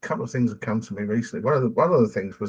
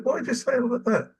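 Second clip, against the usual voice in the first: asks it higher, open and puzzled; higher, puzzled "why did you say it like that"; curious questioning voice; 12 semitones higher than usual. Shown as a demonstration. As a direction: show how to ask why, genuinely curious. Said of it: "That's what we gotta do".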